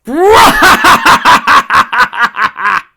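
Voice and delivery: booming evil villain laugh; big high shouted cackle; Lord Zed laugh. Acted. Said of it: "Let's see if I can do a Lord Zed laugh".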